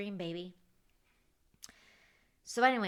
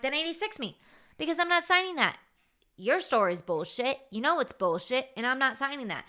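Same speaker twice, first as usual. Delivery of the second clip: retold defiance to the restaurant owner, raised and heated; retold defiant retort; 4 semitones higher than usual. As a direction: throw it back at the owner, daring him.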